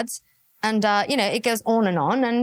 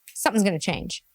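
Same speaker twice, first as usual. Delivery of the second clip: ordinary talk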